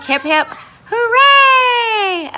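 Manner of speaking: high, loud mock cheer; a big playful hip hip hooray. Teacher cheers last assignment.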